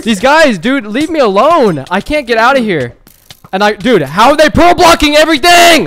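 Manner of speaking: high, loud exasperated wail; near-tears frustration. Real, not acted. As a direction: wail it, at the end of your rope. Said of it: "Yo, don't cry".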